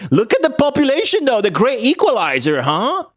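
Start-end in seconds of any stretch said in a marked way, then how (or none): none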